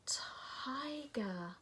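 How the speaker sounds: breathless whispered word; awed whisper. Acted. Nora seeing the tiger, awed whisper. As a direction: whisper it in awe.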